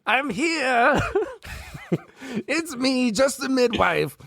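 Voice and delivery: very high, falsetto midwife voice with a giggle; disguised villain playing a giggly midwife. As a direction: squeak it out like a fake midwife.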